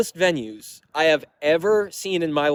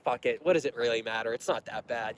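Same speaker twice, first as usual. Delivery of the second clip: resigned shrug; 6 semitones lower, 10 dB quieter than usual. Retold or imitated. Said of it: "we're just like".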